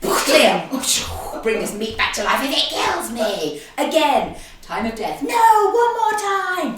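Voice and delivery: loud, slow frantic shouts; hammed-up ER doctor. Host acts a TV ER doctor shocking dinner back to life, loud and frantic. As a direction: yell like a TV surgeon saving a patient.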